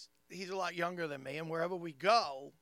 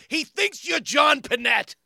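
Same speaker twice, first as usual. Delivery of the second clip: loud, high, slow blurt; punchline shout; 8 semitones higher, 12 dB louder, slower than usual. Retold or imitated. Comic deflating friend's ego, loud slow punchline blurt. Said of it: "And I just go".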